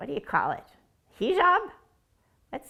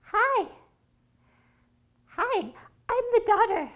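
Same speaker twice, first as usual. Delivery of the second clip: bright greeting; 8 semitones higher than usual. Acted.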